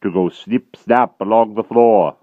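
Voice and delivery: loud, slow, heavy giant voice; dim brutal giant. A narrator's reading. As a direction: drag each word out like a lumbering brute. Giant musing cruelly.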